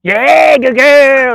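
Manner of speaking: high, loud praise burst; shrill-free big cheer for the dog; excited praise. Real, not acted. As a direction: celebrate her catch.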